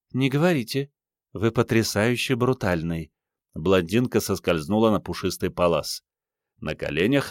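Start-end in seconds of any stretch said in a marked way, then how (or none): 0.1-3.1 higher, lilting blonde; melodic, admiring coquette; soft musical voice. A narrator's reading. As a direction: coo it flirtatiously in a high feminine voice. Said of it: "Голосок у неё был мягкий, музыкальный".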